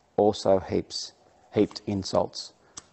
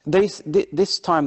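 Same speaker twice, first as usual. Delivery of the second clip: normal talking voice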